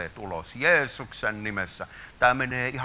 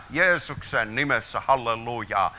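ordinary talk, nothing marked

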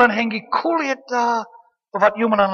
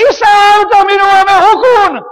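higher and louder, crying out to Father; desperate cry for help; 11 semitones higher, 12 dB louder than usual. Acted. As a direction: call out like someone going under. Acts a drowning believer's cry. (epätoivoinen avunhuuto)

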